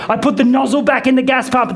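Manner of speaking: usual speaking voice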